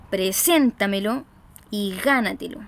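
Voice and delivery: "preséntamelo y gánatelo", punching SÉN and GÁ; "preséntamelo y gánatelo" with weight on SÉN and GÁ; early-syllable stress. Shown as a demonstration. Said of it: "se acentúan en la sílaba anterior".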